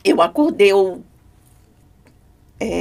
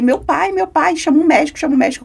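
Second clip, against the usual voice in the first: higher and louder, panicked calls for a doctor; desperate pleading; 7 semitones higher, 4 dB louder than usual. Retold or imitated. Woman recalling her dream, begging someone to call a doctor, panicked. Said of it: "Eu começava a ficar desesperada".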